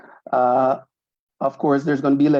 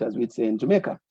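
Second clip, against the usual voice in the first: normal talking voice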